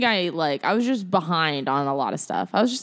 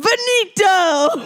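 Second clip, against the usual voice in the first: a big, high, drawn-out finale cry; slow, loud, and overblown; dramatic finale; 8 dB louder, much slower than usual. Retold or imitated. Retelling the debate partner's dramatic ending, hammed-up cry. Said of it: "and so she just went".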